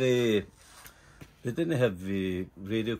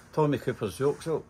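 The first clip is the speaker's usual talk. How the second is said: ordinary delivery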